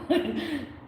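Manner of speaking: bright high-pitched burst of laughter; high giggle. Real, not acted. Reader laughing at the Scottish words she hears, giggling. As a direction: let a real giggle escape.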